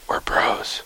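hushed, unvoiced-breath whisper. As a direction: whisper it like a secret between buddies. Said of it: "Bro, why'd you whisper it".

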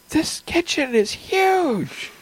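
high, slow, wide-eyed robot cat; excited robot cat. Pretend. GM voicing the robot cat finding the stairs, high and slow. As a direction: gasp it out like a thrilled newborn machine. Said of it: "he's like".

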